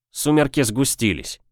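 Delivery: plain reading voice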